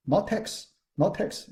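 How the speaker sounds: "text" said teks, with a KS ending; wrong pronunciation. Shown as a demonstration. Teacher warns students off the wrong reading, "text" as teks. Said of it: "the author like to pronounce".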